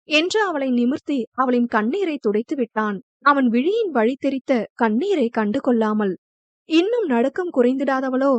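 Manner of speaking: usual speaking voice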